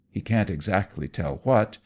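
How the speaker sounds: plain reading voice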